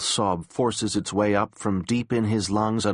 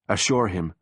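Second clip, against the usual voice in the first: plain reading voice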